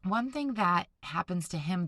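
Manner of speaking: ordinary delivery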